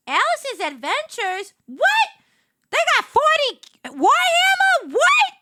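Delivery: very high, loud disbelief; astonished. Real, not acted. Streamer discovering special game packs, astonished outburst. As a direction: squeal it in shock.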